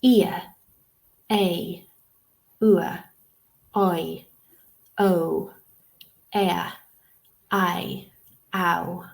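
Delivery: each vowel glide isolated with pauses; two sounds merged into one, said singly; diphthong demonstration. Shown as a demonstration. Teacher reading the diphthong chart aloud, isolated sounds. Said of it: "let's read through them".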